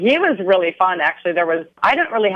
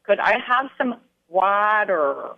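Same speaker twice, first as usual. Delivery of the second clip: imitating Schenker saying water in a Minnesota accent, with a long flat ah and a hard r; water drawn out carefully in a Minnesota accent; a German learner copying a Minnesota accent; 3 semitones higher, 3 dB quieter, slower than usual. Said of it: "delivering the word water in a full-on Minnesota accent".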